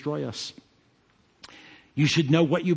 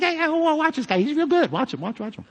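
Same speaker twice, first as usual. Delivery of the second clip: imitating excited father-in-law; 11 semitones higher than usual. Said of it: "he's like".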